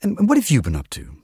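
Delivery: bright, lifted lady's voice; suddenly bright. A narrator's reading. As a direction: perk up suddenly with a bright question. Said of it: "she says suddenly brightly".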